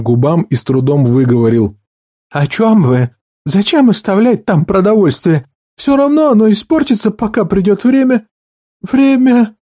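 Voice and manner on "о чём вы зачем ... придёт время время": much higher, frightened voice; horrified whisper-cry. A narrator's reading. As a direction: panic rising as he realizes. Val Nyra realizes, horrified. (в ужасе, высоким голосом)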